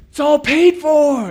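high, loud, drawn-out cry; gleeful announcement. Retold or imitated. Manager to man with big taco order, long high holler. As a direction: belt it out slowly for the whole place.

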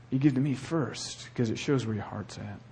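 usual speaking voice

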